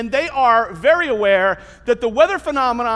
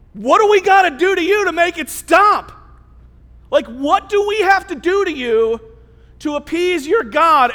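raised, loud, emphatic plea; exasperated demand; 4 semitones higher, 6 dB louder than usual. Acted. Desperate crew asking Jonah how to stop it, exasperated. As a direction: demand a fix, voice pushed up. Crew pleading to end storm.

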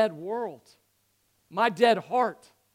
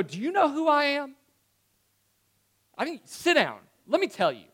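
high, raised, mock-offended challenge; higher-pitched indignant put-down; 6 semitones higher, 3 dB louder than usual. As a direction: pull rank in mock outrage. Mock indignant reply imagined for Jesus.

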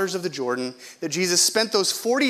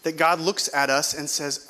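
ordinary talk, nothing marked

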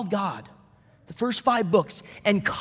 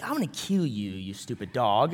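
low, soft mock menace; mock threat; 9 semitones lower, 7 dB quieter than usual. Owner to his talking dog, in mock menace. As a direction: pretend threat, dropped low under the voice.

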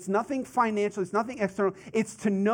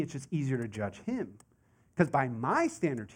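usual speaking voice

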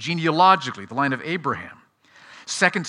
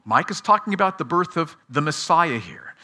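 ordinary talk, nothing marked

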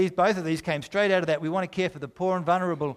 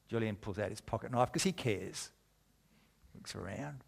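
1 conspiratorial hush; 5 semitones lower, 10 dB quieter than usual. Acted. Sneaky theft in story.

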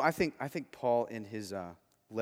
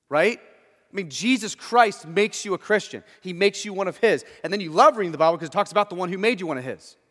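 loud, high fiery sermon; preacher condemning the self-righteous, loud and high; preaching at full force; 7 semitones higher, 11 dB louder than usual. Acted. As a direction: thunder it at the congregation.

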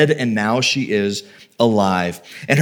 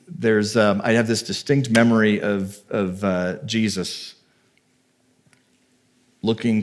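ordinary talk

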